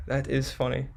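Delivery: ordinary delivery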